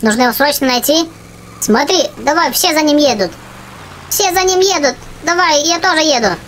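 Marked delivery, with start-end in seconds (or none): none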